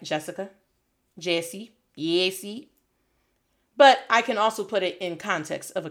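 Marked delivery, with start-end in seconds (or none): 0.0-2.7 Jessica, Jessie, Yessie sliding to a Y; name said three ways. Shown as a demonstration. Teacher shows name forms to class. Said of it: "I could just be like".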